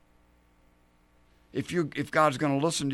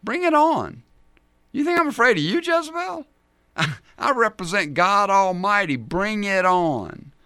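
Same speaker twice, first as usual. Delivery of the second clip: high, loud hammed-up defiance; bold Elijah; 8 semitones higher, 6 dB louder than usual. Imagined Elijah answering Jezebel's threat, loudly defiant. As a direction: shout it like a fearless challenger, stretching the last words. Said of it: "how would he respond?".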